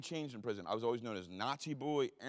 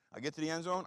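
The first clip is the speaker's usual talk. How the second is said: usual speaking voice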